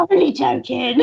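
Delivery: imitated false jollity, breaking into laughter; mock-sinister joking. Customer after asking for free face paint, imitated sinister joking. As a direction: toss out the take-back in his manner. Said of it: "and it's really sinister".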